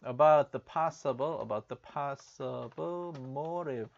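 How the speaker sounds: "about the possible motive" drawn out slowly word by word; slow the phrase right down so learners can follow; slow repeat. Shown as a demonstration. Teacher repeating the news line slowly for students. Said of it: "repeating the news line slowly".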